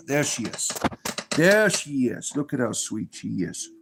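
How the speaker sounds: soft, fond cooing over the rabbit; showing off his rabbit with soft affection; tender pet talk. Real, not acted. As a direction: murmur lovingly to the bunny.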